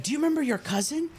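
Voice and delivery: high, fast mock mom voice; mom's voice. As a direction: pitch it up and rattle it off like mom on the phone. Mom calling, voiced by son.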